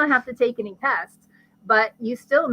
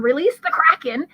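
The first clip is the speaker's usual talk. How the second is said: higher, louder mock-commanding cry; mock yell; 5 semitones higher, 5 dB louder than usual. Pituitary queen orders the adrenals into action, mock yell. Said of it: "so she yells down".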